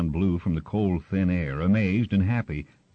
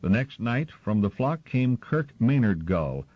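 ordinary delivery, nothing marked

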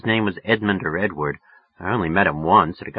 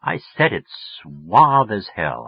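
ordinary delivery, nothing marked

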